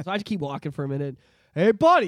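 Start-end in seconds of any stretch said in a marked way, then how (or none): 1.6-2.1 a louder retold call of hey buddy; mock yell. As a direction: call out to him louder, half-acted. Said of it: "he yells a second time".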